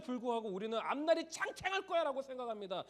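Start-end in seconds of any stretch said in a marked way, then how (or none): 0.8-2.2 imitated carefree boast. Preacher voices a hopeful young thought.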